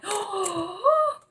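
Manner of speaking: sharp high gasp of delight; excited gasp. Real, not acted. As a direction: gasp at the good news. Excited discovery in reading.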